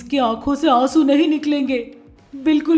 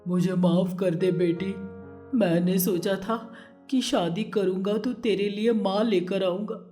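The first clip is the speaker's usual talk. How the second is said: a touch of tearful father; 2 semitones lower than usual. A narrator's reading. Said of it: "Vasu ये देखकर रोने लगा".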